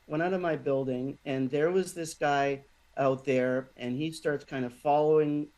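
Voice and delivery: ordinary talk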